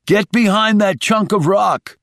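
high, urgent shouted order; shouting. A narrator's reading. Shaw ordering wounded Rice behind the rock, shouting. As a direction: order a wounded man into cover. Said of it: "he shouted".

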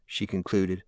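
ordinary delivery, nothing marked

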